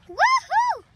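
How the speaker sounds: whooping cheer; loud high woohoo. Real, not acted. Kid cheering on swings, loud high woohoo. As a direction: let out a big woohoo.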